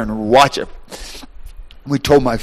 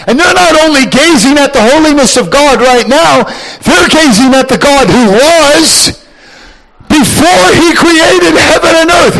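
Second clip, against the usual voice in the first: high, loud preacher's fervour; full-force preaching; 12 semitones higher, 7 dB louder than usual. Acted. Preacher fires up the congregation, loud and high. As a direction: preach it at full volume to the crowd. Preacher explains God who was.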